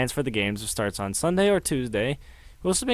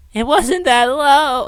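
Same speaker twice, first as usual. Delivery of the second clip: high, loud, drawn-out cry; shrieked denial, stretched out; horrified outcry; 13 semitones higher, 9 dB louder, slower than usual. Acted. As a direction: cry out in panicked disbelief. Character repeats it in horror.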